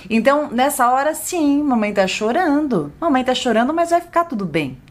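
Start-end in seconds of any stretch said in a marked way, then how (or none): 1.1-4.8 put-on soothing higher-pitched voice to a child; tender motherly reassurance, lifting at the end; reassuring mother. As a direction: gently admit it, then comfort.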